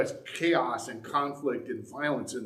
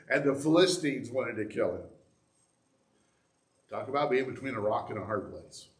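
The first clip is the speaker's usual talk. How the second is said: ordinary talk, nothing marked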